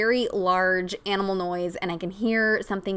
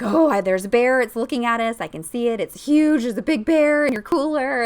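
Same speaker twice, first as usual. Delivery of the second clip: alarmed; 3 semitones higher, 5 dB louder than usual. Retold or imitated. Stranger spotting the bear, alarmed.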